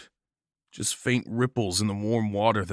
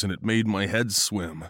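ordinary delivery, nothing marked

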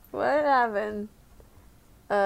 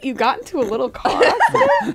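laughing disbelief; 6 semitones higher, 4 dB louder than usual. Retold or imitated. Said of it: "I was like".

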